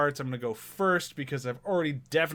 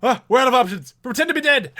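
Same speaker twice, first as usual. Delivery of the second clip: high, loud panicked line; panicked character; 10 semitones higher, 9 dB louder than usual. Retold or imitated. Quoting the character in the army scene, hammed-up panic. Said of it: "Just the".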